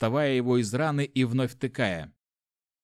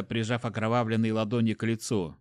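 ordinary delivery, nothing marked

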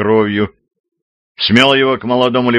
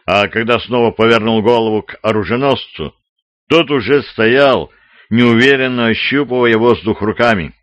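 plain reading voice

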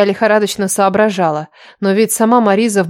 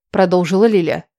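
ordinary delivery

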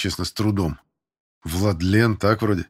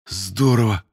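understated impressed exclamation; mild admiration; slower than usual. A narrator's reading. Recruit praises the instructor's move, mildly admiring. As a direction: admire it with a touch of awe. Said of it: "восхитился Бернес".

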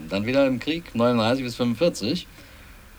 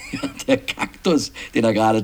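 laughing. Real, not acted. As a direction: laugh while recalling an absurd detail. Amused at absurd detail, laughing.

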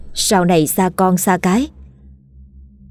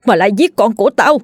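shouting at ghosts. A narrator's reading. Grieving father yells at the laughing ghosts, furious defiance. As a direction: hurl defiance at the mocking spirits. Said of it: "ông quát lên".

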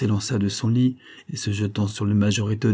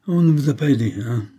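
plain reading voice

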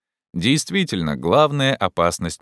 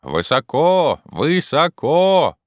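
long drawn-out, high holler; calling down; 9 semitones higher, 4 dB louder, much slower than usual. Acted. Jupiter answers from the treetop, calling down. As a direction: stretch each word so it carries. Climber answers from far above.

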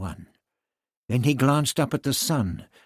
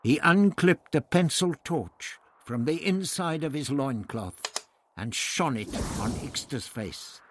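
usual speaking voice

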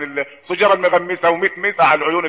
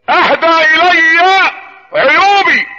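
high, extremely slow preacher's recitation; ringing proclamation; 9 semitones higher, 5 dB louder, much slower than usual. Real, not acted. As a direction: stretch "اهدى الي عيوبي" out high and strong. Inscription repeated as ringing proclamation. ("اهدى الي عيوبي" بإلقاء عالي وممدود جدًا)

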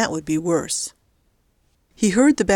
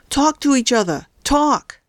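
raised, higher-pitched command; calling loudly; 8 semitones higher than usual. A narrator's reading. Kidnapper holding two phones together, calling out loudly. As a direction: call it out, pitch climbing on the last word. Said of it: "and called loudly".

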